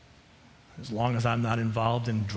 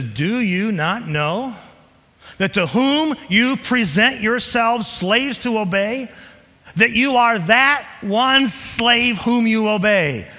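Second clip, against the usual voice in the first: slow, deliberate, louder reading; emphatic scripture reading; 10 semitones higher, 7 dB louder, slower than usual. Acted. Preacher reading Romans to the congregation, slow and emphatic. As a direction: weigh every word.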